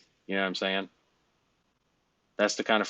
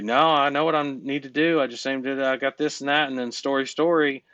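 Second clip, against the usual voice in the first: higher, eager voice of someone making excuses; imitating a client who talks his way out; client's excuses; 5 semitones higher, 4 dB louder than usual. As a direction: lightly play the excuse-maker. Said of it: "and you would say".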